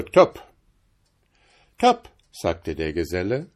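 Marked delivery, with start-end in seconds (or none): 0.0-0.4 loud clipped devil's agreement, a touch of gruffness; devil's topp. Acted. Devil closes the bargain with the journeyman, loud. 1.8-2.1 much higher, bright answering topp; journeyman's topp. Acted. Journeyman echoes the devil's deal, high and pleased.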